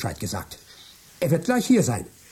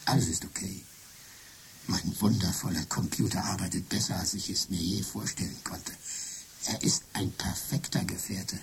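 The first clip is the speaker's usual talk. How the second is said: much softer, lower and calm fake Michael; computer faking Michael; 7 semitones lower, 10 dB quieter than usual. Computer as Michael calming Jenny, soft and low. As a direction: soothe the wife in a borrowed voice. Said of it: "Ich kann deine Stimme imitieren".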